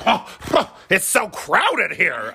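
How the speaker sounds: high, slow whiny complaint; whiny shopper. Retold or imitated. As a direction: whine like a fed-up Target shopper. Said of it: "how people are when they're just like".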